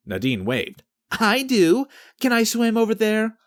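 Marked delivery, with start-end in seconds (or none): 1.1-3.4 put-on a lightly raised, higher young woman's voice; Nadine's eager voice. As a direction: chime in keenly, pitched up. Nadine speaks up.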